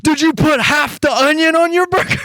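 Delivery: very high incredulous squeal; mock a friend's tearful disbelief; imitating upset friend. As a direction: shriek it in hammed-up outrage, about to burst out laughing. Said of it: "why you're so mad at me".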